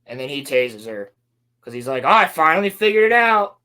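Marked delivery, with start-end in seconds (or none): 2.0-3.6 higher, louder hammed-up triumph; mock triumphant outburst. Imitating him as he tases her, loud mock triumph. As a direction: blurt it out like a big mock discovery. Retelling his sudden realization.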